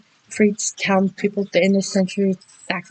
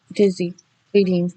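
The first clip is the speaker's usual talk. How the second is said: plain reading voice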